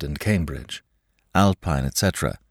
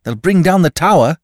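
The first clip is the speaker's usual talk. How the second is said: fearful, higher and louder; alarmed; 11 semitones higher, 8 dB louder than usual. A narrator's reading. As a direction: near panic. Feverish master fears collapse.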